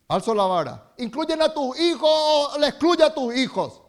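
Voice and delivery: loud, rising sermon climax; fervent preaching. Acted. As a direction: build to a shouting peak for the church.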